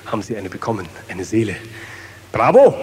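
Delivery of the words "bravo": loud bravo cheer. Acted. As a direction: call out like a crowd. Cheering crowd.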